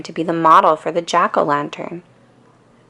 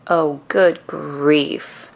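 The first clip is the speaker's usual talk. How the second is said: slow, drawn-out exasperation; exasperated; much slower than usual. A narrator's reading. Lucy fed up with Linus, slow exasperated groan. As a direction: roll your eyes through it. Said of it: "Lucy exclaims".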